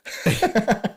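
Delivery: high, loud laughing burst; big burst of laughter. Real, not acted. Answering quick-fire questions, he bursts out in loud laughter. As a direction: laugh out loud at his own joke.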